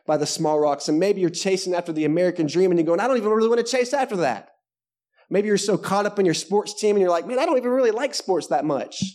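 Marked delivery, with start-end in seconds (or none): 3.0-4.5 high, quick put-on whine of protest; imagined reluctant protest; mock complaint. Acting a reluctant listener. 7.3-9.0 lightly put-on higher-pitched protest; mildly exasperated imagined confession; mock complaint. Acting a reluctant sports parent.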